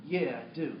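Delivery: a soft, deflated yes. Retold or imitated. Young man finally gives in, soft and deflated. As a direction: let the air go out of him. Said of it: "And he deflates".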